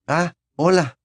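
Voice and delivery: bewildered. A narrator's reading.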